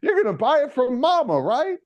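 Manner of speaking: high, sing-song ad pitch voice; hamming up a folksy fried-chicken saleswoman; mock Popeyes ad woman. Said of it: "this woman who reminds you of, like, those racist Popeyes commercials".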